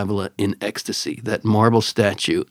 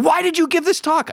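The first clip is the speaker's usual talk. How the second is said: high, insistent mimicked woman's voice; Italian lady. Italian lady questions the pilgrimage leader, high urgent imitation. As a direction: press the question, pitched way up. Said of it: "I can't do the Italian accent".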